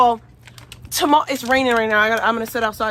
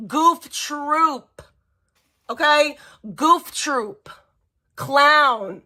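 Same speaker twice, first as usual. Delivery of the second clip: slow emphatic insult; much slower than usual. Real, not acted. Insulting the rival, slowed down.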